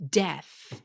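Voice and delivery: ordinary talk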